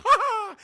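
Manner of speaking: loud, high gleeful laugh; hammed-up high-pitched "Ha ha!"; excited cackle. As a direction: crow with triumphant laughter. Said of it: "He's so excited".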